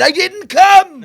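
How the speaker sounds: very loud, high, drawn-out protest; hammed-up outraged holler; indignant shout. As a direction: yell a defensive denial, half laughing. Acts caught man's indignant protest.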